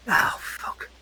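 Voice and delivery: breathy whispered swear under the breath; whispered curse. Acted. Marazzo cursing quietly at his dog, whispered. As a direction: hiss the curse to yourself.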